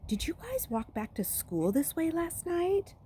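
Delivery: put-on girl's voice. Nina voiced as a girl.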